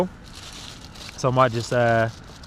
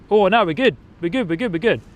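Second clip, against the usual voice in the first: startled relief; 5 semitones higher, 4 dB louder than usual. Real, not acted. Opening shaken Diet Coke live.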